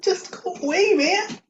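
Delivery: high, strained plea through cramp; in real pain. Real, not acted. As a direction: beg the cramp to leave. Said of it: "I know how painful that is".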